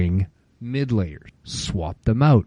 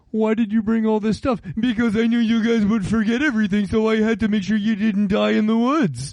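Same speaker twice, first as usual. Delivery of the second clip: high put-on voices, quick; mock exchange; 10 semitones higher than usual. Kids questioning his heavy pack, high mock voices. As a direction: act out the kids and your smug reply. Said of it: "And they're like".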